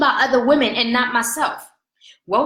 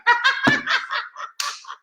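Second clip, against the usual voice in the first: high giddy laugh; 13 semitones higher than usual. Real, not acted. Laughing at her own excitement.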